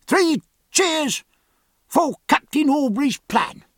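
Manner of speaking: very high cheerleader's call; high, ringing acted shout; crying out. A narrator's reading. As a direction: call for three cheers from the crowd. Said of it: "cried a perfectly unexpected voice".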